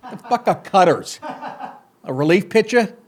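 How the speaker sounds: ordinary talk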